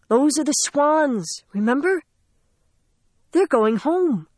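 gentle coaxing. Acted. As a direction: coax him gently to look up. Sarah coaxing Charlie, narration.

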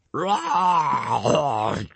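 gurgling roar. A narrator's reading. Corpse roaring into Gobber's face, gurgling roar. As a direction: roar through a throatful of water. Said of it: "gurgled the corpse of Grimbeard".

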